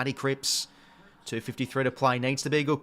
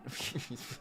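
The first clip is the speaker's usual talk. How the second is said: quiet laugh; 13 dB quieter than usual. Real, not acted. Laughs at a player's poor game.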